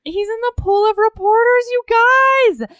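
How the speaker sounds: high, loud excited gushing. Real, not acted. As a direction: gush about the scene. Excited retelling.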